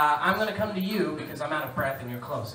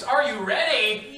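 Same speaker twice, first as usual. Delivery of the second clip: hype call; 4 semitones higher, 6 dB louder, slower than usual. Acted. Host pumps up contestants.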